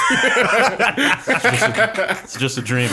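high, loud helpless laughter through the words; laughing. Real, not acted. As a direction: talk while cracking up. Helpless laughter after an awkward remark.

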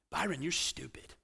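quoting a put-down. Retold or imitated. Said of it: "I grew up hearing that".

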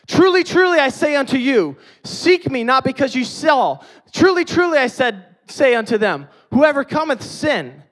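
high, loud preacher's proclamation; full-force preaching. Acted. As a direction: thunder the words of Jesus at the congregation. Preaching Jesus' words at full force.

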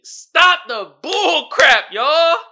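slow, loud, high-pitched appeal; drawn-out emphatic protest; exasperated plea. Real, not acted. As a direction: stretch each word out high and loud. Final plea to viewers.

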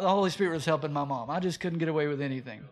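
ordinary talk, nothing marked

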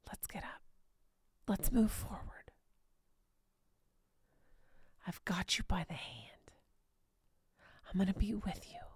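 God whispering gently to the listener; compassionate whisper. Acted. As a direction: hold their hand with your voice. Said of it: "in the most compassionate way possible".

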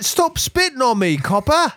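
high shouted mock British drunk; angry Brit shout. Imitating the drunk Brit shouting at the Tyrolean cop. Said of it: "He's like".

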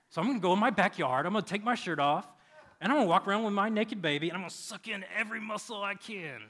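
loud, high, hammed-up declaration; comic hunk-dad boast, belted out; mock bravado. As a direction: build into a rushed, puffed-up proclamation. Boasting inner monologue, acted for laughs.

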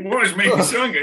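high, quick, baffled mock anger; hurried, high-pitched pretend exasperation; mock fuming. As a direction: imitate a fuming parent for laughs. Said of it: "this fucking fuming mood".

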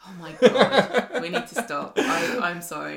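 drawn-out, appalled words through laughter; laughing. Real, not acted. Cracking up at co-host's accent, laughing. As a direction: giggle helplessly while begging to stop.

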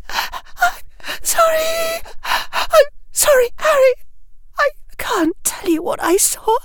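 very high, tearful gasping apology; sobbing in gasps. A narrator's reading. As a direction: sob it out between gasps. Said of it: "Hermione said in gasps".

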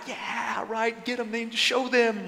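quiet, egging-on bystander; cheering onlooker. Retold or imitated. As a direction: cheer them on under your breath. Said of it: "These guys are in the background like".

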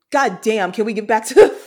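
retold exasperated plea. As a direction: replay your overwhelmed viewer reaction. Acting out own reaction to scene.

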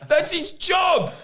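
high, drawn-out shout of complaint; comic exasperated yell; exasperated outburst. Real, not acted. Exasperated rant about the tour organiser.

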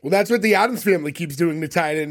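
ordinary talk

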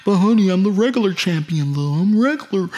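high, loud mock-pleading husband. Regular champion defends himself to his wife, loud mock pleading. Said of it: "You tell your wife, like".

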